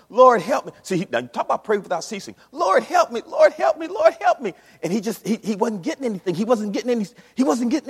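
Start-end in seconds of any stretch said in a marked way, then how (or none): 0.1-0.7 desperate cry. Retold or imitated. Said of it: "He's saying". 2.5-4.5 high, loud, panicked "Lord, help me"; frantic cry for help. Retold or imitated. Preacher fleeing the bear yells for the Lord's help.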